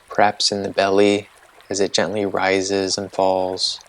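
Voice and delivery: calm, unhurried meditation guide voice; guided meditation. Acted. Host guiding listeners through a body-sensation meditation, calm and unhurried.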